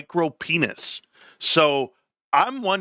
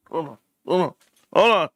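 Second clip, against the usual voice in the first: urgent hold on. Real, not acted. Streamer noticing his light is off, urgent.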